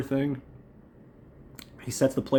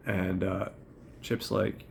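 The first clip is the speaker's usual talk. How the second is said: usual speaking voice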